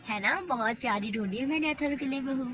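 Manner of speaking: ordinary talk, nothing marked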